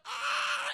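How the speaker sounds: very high-pitched mock scream; drawn-out scream of horror, acted. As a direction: shriek like a startled movie victim. Maitre d' sees melted Steve.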